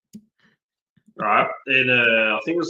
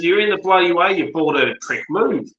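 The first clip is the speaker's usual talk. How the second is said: road rage shout; 6 semitones higher than usual. Retold or imitated. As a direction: yell at the parked driver to move. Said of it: "I said".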